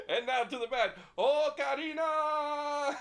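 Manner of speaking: high, loud, stretched Spanish ballpark call; baseball announcer. Pretend. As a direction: announce the batter to the whole stadium. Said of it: "It's like a baseball game".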